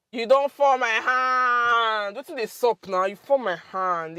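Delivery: loud wail with "hand" dragged out; acted dismay. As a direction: stretch the last word in mock disappointment. Acting out disappointment at someone.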